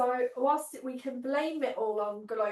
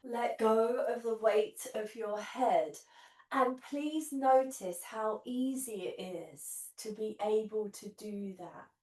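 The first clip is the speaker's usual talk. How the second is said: guided meditation. Acted. Leads guided relaxation on mat.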